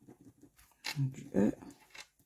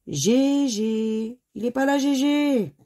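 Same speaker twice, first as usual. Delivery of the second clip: long, higher-pitched mock call; drawn-out searching call, a little louder; playful calling out; 4 semitones higher, 4 dB louder, slower than usual. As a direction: stretch the name as if calling someone missing. Playfully calls out for missing letter.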